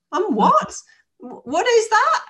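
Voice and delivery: high, loud retold astonishment; incredulous squeak of confusion; stunned disbelief. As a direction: replay the moment it floored you. Stunned by the word perimenopause.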